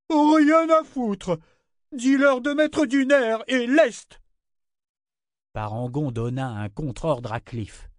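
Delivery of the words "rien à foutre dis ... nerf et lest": very high, slow, drawn-out yell; defiant shout. A narrator's reading. Ship countering the foreman's gentle order, defiant shout. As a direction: override him with a stretched angry cry.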